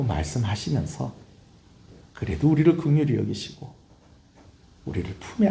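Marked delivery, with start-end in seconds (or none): none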